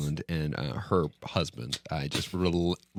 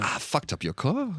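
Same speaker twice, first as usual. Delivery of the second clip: put-on New Zealand accent; 9 semitones higher than usual.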